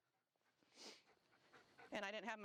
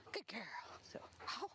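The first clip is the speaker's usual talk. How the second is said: whispered praise. Real, not acted. Trainer whispering praise to the dog.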